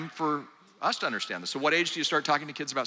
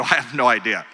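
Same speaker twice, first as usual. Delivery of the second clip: laughing admission; 5 dB louder than usual. Retold or imitated. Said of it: "And I go".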